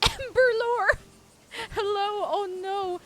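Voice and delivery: high, loud happy hello; excited greeting. Real, not acted. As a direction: greet them with a squeal.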